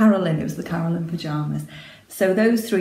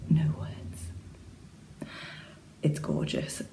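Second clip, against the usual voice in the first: breathless whispered awe; whisper, lost for words; awed whisper; 10 dB quieter, slower than usual. Real, not acted. Overcome by aunt's fabric.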